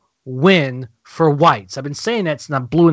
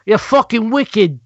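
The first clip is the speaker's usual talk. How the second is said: Boston accent; 7 semitones higher, slower than usual. Shown as a demonstration. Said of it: "you sound pretty Boston already".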